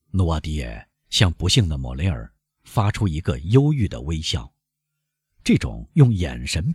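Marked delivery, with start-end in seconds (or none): none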